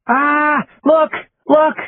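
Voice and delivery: put-on high, eager child's squeal; excited little girl. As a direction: point at the TV, thrilled. Small child sees dad on TV.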